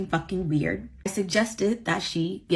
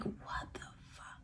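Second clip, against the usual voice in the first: barely voiced, mouthed aside; stunned whisper; 25 dB quieter than usual. Friend stunned at being dismissed, stunned whisper. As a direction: whisper it in silent disbelief. Stunned reaction in her retelling.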